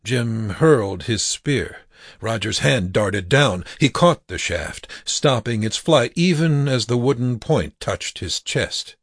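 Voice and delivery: ordinary delivery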